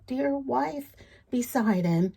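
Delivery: plain reading voice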